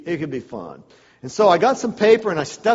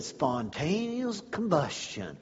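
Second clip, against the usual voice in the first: awed repeat; 8 dB quieter, slower than usual. Retold or imitated. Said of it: "And I said".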